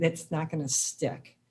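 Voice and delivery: normal talking voice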